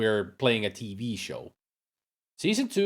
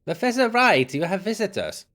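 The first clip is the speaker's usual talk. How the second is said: put-on German accent; 10 semitones higher than usual. Housekeeper announcing visitors to professor, German-accented. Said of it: "why did I give her a German accent".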